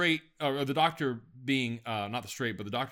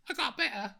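high-pitched comic Cockney lady; put-on Cockney falsetto, much higher; Monty Python lady Cockney; 12 semitones higher than usual. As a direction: squawk it like a Python Cockney woman. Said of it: "in the Monty Python Lady Cockney voice".

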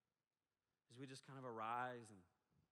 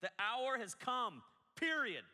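slow, high, shouted declaration; loud proclamation; 10 semitones higher, 11 dB louder, slower than usual. Shown as a demonstration. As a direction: proclaim it slowly at full force. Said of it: "exclamation point, which means".

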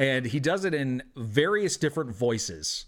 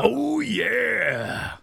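higher Kool-Aid Man cry; 9 semitones higher, 3 dB louder than usual. Acted. Bartender to the party, a Kool-Aid Man impression. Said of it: "That's the Kool-Aid Man".

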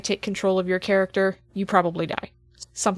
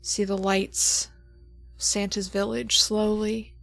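game master scene setting; 4 dB quieter than usual. Acted. GM sets the opening scene.

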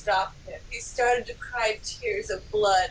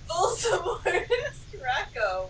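mock shout; 7 semitones higher than usual. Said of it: "we shouted at the same time".

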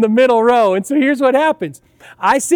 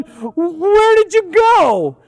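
panicked shout; 8 semitones higher, much slower than usual. Retold or imitated. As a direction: yell it slowly as a terrified kid on a coaster. Panicked kid on roller coaster.

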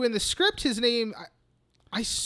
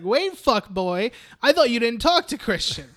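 mock call-out; 4 semitones higher, 7 dB louder than usual. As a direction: catch him in the lie. Calling out Pele's lie.

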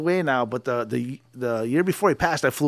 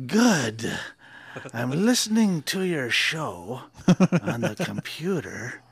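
slow soft dad voice; 3 semitones lower, 6 dB quieter, much slower than usual. Retold or imitated. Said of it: "He goes".

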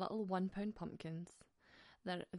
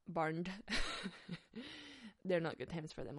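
normal talking voice

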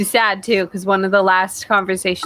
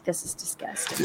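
disgusted whisper; 16 dB quieter than usual. Retold or imitated. Listeners react to the show.

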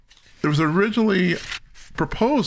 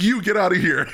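laughing, mock shooing; laughing dismissal; 5 semitones higher than usual. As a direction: say it through a laugh. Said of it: "it's like".

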